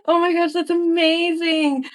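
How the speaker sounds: high delighted squeal at a package; freaking out. Real, not acted. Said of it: "I'm freaking out".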